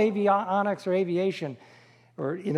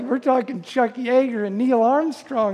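ordinary talk

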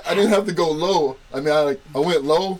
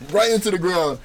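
ordinary talk

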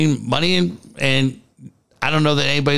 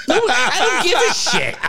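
laughing; 10 semitones higher than usual. Real, not acted. Laughing after the joke.

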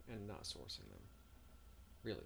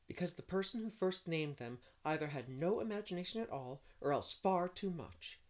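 Anne's answer; 9 semitones higher, 7 dB louder than usual. Acted. Voices Anne answering.

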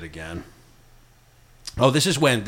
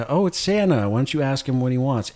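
higher, sing-song voice of a mom; sweet mother; 5 semitones higher than usual. Retold or imitated. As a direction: coo it like a delighted mom.

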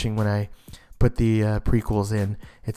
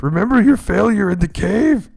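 a hint of a looming, louder voice; lightly voiced movie taunt; quoted movie line; 7 semitones higher, 5 dB louder than usual. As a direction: echo the taunt a bit higher and louder. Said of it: "quoted movie line".

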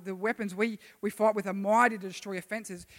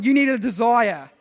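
emphatic declaration; 2 semitones higher, 8 dB louder than usual. Shown as a demonstration. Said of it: "Tell them".

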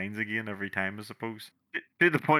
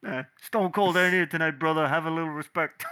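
lightly voiced growl of Eddie Kingston, raised; a light take on Eddie's crowd scold; hint of Kingston scolding the crowd; Eddie Kingston impression; 6 semitones higher than usual. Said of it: "That's the way he says it".

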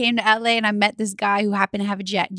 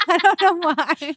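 laughing; 7 semitones higher, slower than usual. Real, not acted. Laughing after her own joke.

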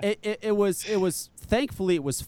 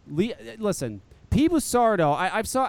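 usual speaking voice

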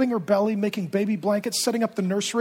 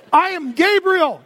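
slow, louder grand declaration; proud announcement; 8 semitones higher, 7 dB louder, slower than usual. Retold or imitated. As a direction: announce the name with pride. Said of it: "the angel said to him".

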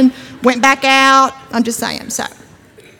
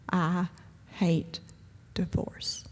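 hushed quote; 2 semitones lower, 10 dB quieter, much slower than usual. Shown as a demonstration.

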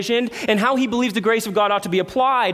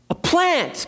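high, falling cry of disbelief; incredulous exclamation; 7 semitones higher than usual. Real, not acted. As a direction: throw it out higher, as if astonished. Incredulous repeat of God's rebuke.

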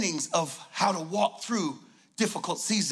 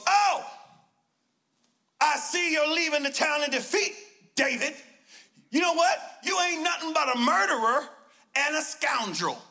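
taunting hater; 5 semitones higher than usual. Acted. Shimei taunting David as he leaves, mock jeering. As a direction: sneer it at the fleeing king. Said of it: "Shimei goes".

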